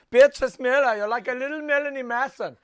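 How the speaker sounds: high, loud camp German; camp German accent. Shown as a demonstration. Host rereading fan mail to understand it, camp German. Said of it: "if I say it in a Camp German accent".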